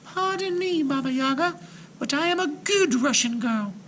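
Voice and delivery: put-on a frightened girl pleading politely; uncertain girl. A narrator's reading. Frightened Marusha pleads with the witch, high and uncertain. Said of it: "in a very uncertain tone".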